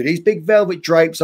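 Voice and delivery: ordinary delivery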